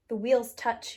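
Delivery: ordinary delivery, nothing marked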